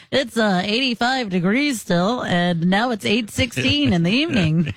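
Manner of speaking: slow, lightly voiced dull announcer; mock weather-and-time announcer. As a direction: drone the time and temperature. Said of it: "Every time they come to me".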